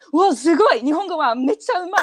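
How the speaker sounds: high, loud burst of mock amazement; gushing praise. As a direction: gush rapidly like an impressed Japanese native speaker. Acted native speaker's amazed praise.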